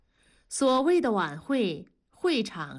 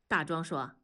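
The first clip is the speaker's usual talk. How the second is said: ordinary delivery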